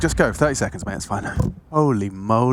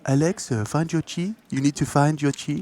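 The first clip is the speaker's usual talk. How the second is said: lightly put-on French accent; a hint of a French accent; 3 dB quieter than usual. Shown as a demonstration. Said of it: "maybe do it in French accent".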